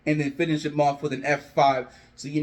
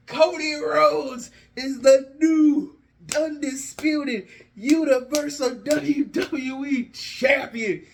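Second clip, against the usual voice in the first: champion announcement; 8 semitones higher, slower than usual. Acted.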